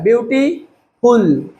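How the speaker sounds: Beautiful slowly split, "beauty", pause, "ful"; two-part breakdown. Shown as a demonstration. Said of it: "दो बार में बोलता है". (Beautiful को दो बार में, रुककर बोलना)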